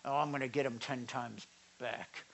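hushed mock revenge vow; mock grudge muttered. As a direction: mutter like someone plotting payback. Mock vengeful person.

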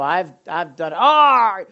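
a parishioner wailing over a mistake; despairing cry. Pretend. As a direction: cry out like someone crushed by guilt. Mock despairing congregant.

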